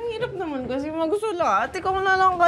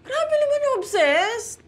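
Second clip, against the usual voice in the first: high, drawn-out protest; indignant; 11 semitones higher than usual. Real, not acted. Jen denies being obsessed, high protest.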